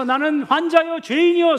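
high, loud cry. Acted. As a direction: call out the confession loudly. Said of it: "소리치며 갔으나".

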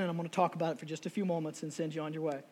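ordinary delivery

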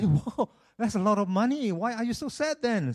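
high-pitched, puzzled astonishment; amazed friend. Acted. Friend hears of the sad man's windfall, amazed. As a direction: react astonished, voice jumping up.

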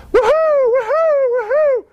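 very high, drawn-out whooping; celebratory yelling. Acted. Neighbor running round the yard at dawn, high ecstatic whooping. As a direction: whoop in long high cries. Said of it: "running around the yard yelling".

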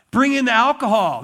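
"bring in the alcohol" shouted loud and high; loud order. Retold or imitated. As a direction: call it out again, just as hard. King repeats the order.

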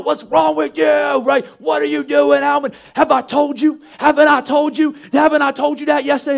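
hammed-up angry parent telling off; yelling. Parent scolding son Alvin, mock angry yell. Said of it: "You yell".

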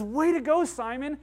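high, loud hearty "Way to go"; Jesus calling out loud praise to Peter; cheering Jesus. Retold or imitated. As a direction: shout the praise up high, delighted. Jesus voiced praising Peter again.

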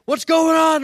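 high, loud acted alarm; panicked yell. Friend woken by gunfire in the tent, panicked shout.

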